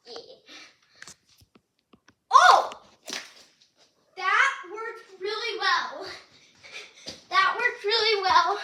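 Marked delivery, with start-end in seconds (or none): 2.3-8.6 loud, high thrilled cheer; overjoyed it flew well; real delighted shout; excited. Real, not acted. Best flight so far.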